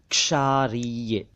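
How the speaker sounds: क्षारीय drawn out slowly as "kshaa-ree-ya"; correct ksh onset. Shown as a demonstration. Teacher correcting learners: क्षारीय slowly as "kshaa-ree-ya". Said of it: "क्षारीय नहीं".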